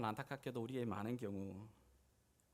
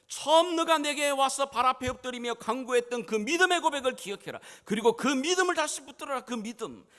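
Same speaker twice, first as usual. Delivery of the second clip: high, very loud exhortation; full-force preaching; 12 semitones higher, 14 dB louder than usual. Acted. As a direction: urge him at the top of your voice. (높고 우렁차게 외치는 권면)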